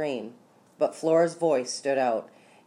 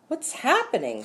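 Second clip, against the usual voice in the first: high-pitched alarmed question, mildly raised; shouted; 10 semitones higher than usual. A narrator's reading. Onlooker asks what is going on, high mock shout. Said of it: "someone shouted".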